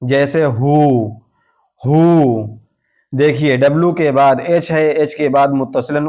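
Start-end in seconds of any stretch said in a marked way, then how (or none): 0.4-2.6 हु said as "hoo", starting on H; silent W. Shown as a demonstration. Teacher models who for learners, हु with silent W. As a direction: say हु with no W sound. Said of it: "इस W को नहीं पढ़ेंगे".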